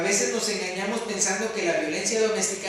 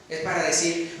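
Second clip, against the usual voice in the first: ordinary delivery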